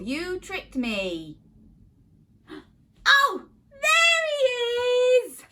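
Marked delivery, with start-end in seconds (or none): 3.1-5.4 excited discovery. Acted. Finally finds the puppet.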